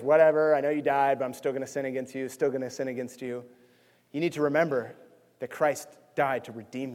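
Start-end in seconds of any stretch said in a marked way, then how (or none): 0.0-3.4 defiant sinner. Retold or imitated. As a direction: toss it off like a careless rebel. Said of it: "in God's face".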